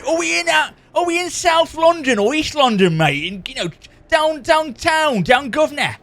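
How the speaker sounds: hammed-up Cockney bloke calling out; Cockney accent. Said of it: "it sounds like you're a Cockney".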